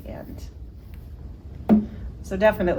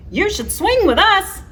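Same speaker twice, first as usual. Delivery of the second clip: much higher, louder monkey voices; excited monkeys; 7 dB louder than usual. Acted. The monkeys inviting Jim to swing, high and excited. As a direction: call out like playful monkeys.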